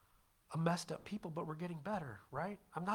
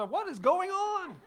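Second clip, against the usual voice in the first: comic disbelief; 14 semitones higher, 8 dB louder, slower than usual. Retold or imitated.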